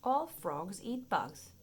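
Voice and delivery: plain reading voice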